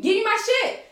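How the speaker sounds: high, pissed-off order; aggressive demand. Retold or imitated. Woman at police car window wants her ID, aggressive demand. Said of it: "I'm getting very aggressive 'cause now I'm pissed off".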